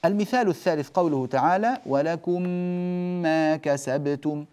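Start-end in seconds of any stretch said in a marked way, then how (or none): 1.9-4.4 slow, one long meem joining ولكم ما; merged meem. Shown as a demonstration. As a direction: press the meems together. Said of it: "إدغام المتماثلين".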